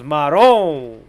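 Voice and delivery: loud Italian-American New York exclamation; exasperated New York wiseguy accent, vowel drawn out; hammed-up New York mob accent; mock New York mobster accent. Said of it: "He goes".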